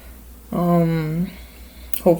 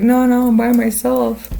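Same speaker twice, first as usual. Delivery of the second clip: excited denial; 7 semitones higher, 4 dB louder than usual. Retold or imitated. Friend's denial over party noise retold.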